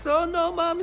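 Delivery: put-on high, loud whimpering child; imitating a crying kid. Child in a crib calling to his mother, crying. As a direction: cry out like a scared toddler. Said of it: "the kid was, like, crying".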